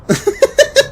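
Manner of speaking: short high-pitched giggle. Real, not acted. Fan laughing at her own shipping rant, high giggle.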